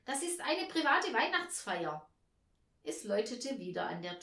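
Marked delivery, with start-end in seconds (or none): 0.0-2.1 raised, agitated objection; nervous. A narrator's reading. Hostess shooing the stranger from her party, nervous. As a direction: protest with a touch of nerves. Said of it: "sagte die Frau nervös".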